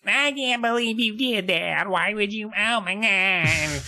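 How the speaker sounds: high, stretched-out nasal complaint; nasal whining. Acted. Pranked girls scold the prankster, high nasal mock whine. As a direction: whine it slowly through the nose. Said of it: "what's going on up in your nasal cavities".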